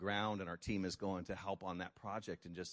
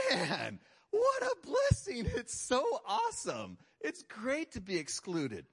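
much higher and louder, slow mock delight; over-the-top fake thrilled believer; sarcastic mock joy; 10 semitones higher, 5 dB louder than usual. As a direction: ham up gratitude for getting fired.

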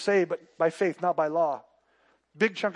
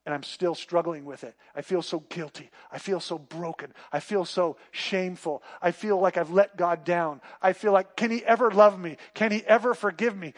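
normal talking voice

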